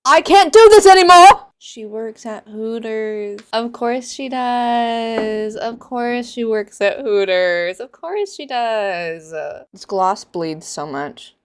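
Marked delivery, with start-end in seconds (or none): 0.0-1.5 loud, higher cry of despair; exasperated scream. Real, not acted. Fed-up reader to viewers, loud despairing outburst. 1.6-9.3 deadpan disbelief. Real, not acted. Deadpan reaction to plot.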